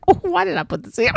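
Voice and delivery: a very high, fast mock cry; mock self-regret. Author mocks his past self, high and fast. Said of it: "I was like".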